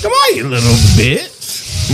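slow taunting witch. Acted. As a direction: croak it out like a witch. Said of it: "Mine's a witch".

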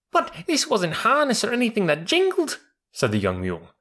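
put-on high, panicky young voice; terrified young mule.

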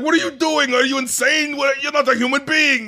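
high-pitched, incredulous mock outrage; appalled scolding. Retold scolding of appalled mentor.

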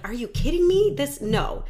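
excited disbelief. Retold or imitated. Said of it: "She's like".

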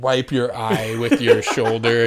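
normal talking voice

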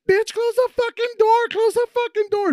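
very high, loud mock shriek; imitating yelling homegirl. Homegirl caught in the car, high mock shrieking. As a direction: screech it like the interrupted friend. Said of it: "all you hear is her homegirl going".

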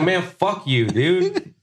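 fed up, venting at the boss; retold anger. Retold anger at boss's lecture.

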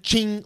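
high, ringing "ching"; imitated cell-door clink. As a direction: mimic metal bars clanging shut with a high ching. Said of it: "it's the clink".

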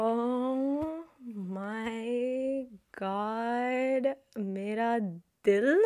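very slow, drawn-out gushing; swooning. Real, not acted. Host reacting to the old lovers' storyline, slow swoon. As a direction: stretch every word into a lovestruck swoon. Swooning over the veteran actors' romance.

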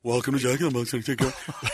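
low imitated drive-thru box voice; drive-thru speaker voice. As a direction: talk like through a speaker box. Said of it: "his voice doesn't sound that bad through the little box".

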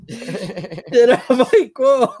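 high, amused retold reaction; laughing reaction. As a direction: laugh through a taken-aback reaction. Laughing reaction to the rude sign.

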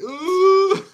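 very high, loud imitated wail; mock crying wail. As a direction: mimic someone crying with laughter in one piercing "ooh".

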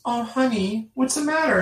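a lightly voiced worried mom. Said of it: "And my mom goes".

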